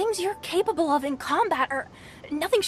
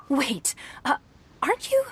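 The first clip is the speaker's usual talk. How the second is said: surprised recognition; 4 semitones higher than usual. Acted. Registrar gasping as he recognises the famous traveler, startled. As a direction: pitch jumps high with a sharp gasp of disbelief.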